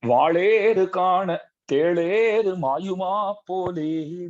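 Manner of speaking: each word drawn out slowly; slow, deliberate quoting of a proverb; slow recited proverb. Shown as a demonstration. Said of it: "एक दृष्टांत है".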